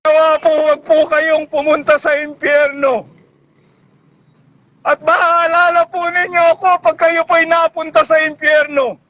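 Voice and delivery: ordinary talk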